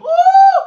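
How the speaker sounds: high, loud whoop of excitement; joyful woo. Real, not acted. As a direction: let out a big preacher's whoop. Preacher's exclamation in full flow.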